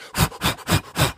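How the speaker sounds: fast voiceless power huffs; crazy breaths. Shown as a demonstration. Showing how you got jacked on adrenaline, hard huffs. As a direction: demonstrate psyching-up breathing. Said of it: "what's that breathing called?".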